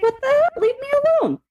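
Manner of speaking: high, mock-fed-up complaint; exasperated protest. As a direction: push him away in high-pitched exasperation. Acted outburst at the texting ex.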